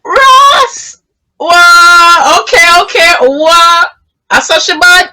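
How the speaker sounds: high-pitched, amazed exclamations; real astonishment. Real, not acted. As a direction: react in disbelief to a wild story. Host amazed by pregnancy story.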